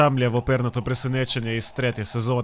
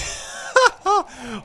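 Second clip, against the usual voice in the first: high, sudden laugh at the chaos; burst of laughter; 11 semitones higher than usual. Real, not acted.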